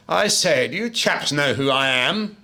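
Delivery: high, loud, plummy officer's indignation; mock-posh naval officer demanding recognition; frightfully upright English admiral. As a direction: play the pompous admiral, affronted. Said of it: "he's frightfully upright".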